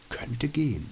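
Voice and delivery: softly, barely voiced, to himself; whispered. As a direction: let it slip out under the breath. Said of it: "flüsterte ich". (leise vor sich hin geflüstert)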